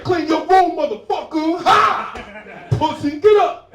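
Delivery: furious full-volume high shout; Brooklyn mom yelling. Acted. Brooklyn mom orders child up, furious loud yelling. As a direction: bellow it like a fed-up Brooklyn mother. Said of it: "My mom's from Brooklyn".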